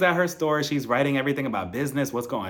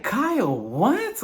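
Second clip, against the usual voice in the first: incredulous; 9 semitones higher, much slower than usual. Real, not acted. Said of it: "reacts in disbelief".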